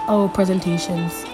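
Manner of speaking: ordinary talk, nothing marked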